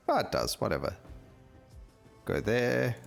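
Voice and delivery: usual speaking voice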